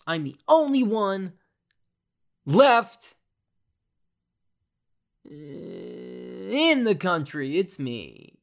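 high, loud showy proclamation; hammed-up boast. Vlogger boasting to viewers, high and showy. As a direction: crow that he's the last one left. Joking he's the only one left.

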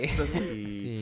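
slow drawn-out plumber catchphrase; Mario voice. Pretend. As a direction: stretch it like a cartoon plumber.

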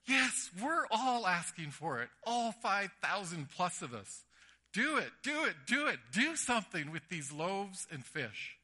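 imitating a delighted kid urging do it; gleeful boy. Boy urging Jesus to multiply the loaves, gleeful and eager. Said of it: "the boy gleefully says".

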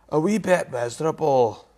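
light Inverness Scots accent. Shown as a demonstration. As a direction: repeat it with a Highland Inverness lilt. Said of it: "that's a bit of an Inverness accent, that one".

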